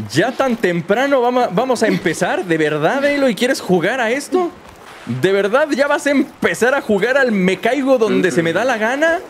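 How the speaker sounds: reproche agudo y exagerado; queja burlona aguda. Pretend. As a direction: fingir indignación chillona. Se queja burlón con Aloy. (reclamo agudo y burlón)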